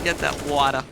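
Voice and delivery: put-on New York accent, "water" as "wada"; brash New York accent push. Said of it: "with a New York accent".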